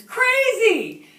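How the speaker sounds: high, very loud drawn-out shout; yelling up. Retold or imitated. Hunters telling the tree sitter off, loud yell. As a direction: holler the insult up the tree. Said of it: "they yelled up".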